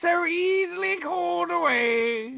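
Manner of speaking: high, slow mock-British crooning; a posh British lyric, hammed up; high, slow British parody. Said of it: "could you be more British".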